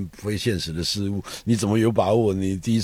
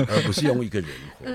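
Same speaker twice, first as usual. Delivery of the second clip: quick words with a laugh in them; laughing through it; 2 semitones higher, faster than usual. Real, not acted. Guest laughing at his own joke about Kant. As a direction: chuckle it out fast at your own joke.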